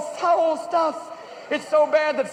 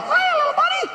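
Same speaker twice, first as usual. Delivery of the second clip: squeaky, very high falsetto greeting; Mickey Mouse. Retold or imitated. Mickey greeting a three-year-old, high squeak. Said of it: "There's Mickey going".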